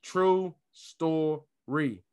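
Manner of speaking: "True story" stretched out slowly; very slow emphasis. Real, not acted. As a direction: drag out "True story" for weight. Drawn-out emphasis.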